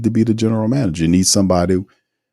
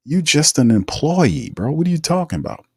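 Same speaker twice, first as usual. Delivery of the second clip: incredulous scoff; 9 semitones higher than usual. Retold or imitated. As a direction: scoff at an employee acting like the owner. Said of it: "And I was like".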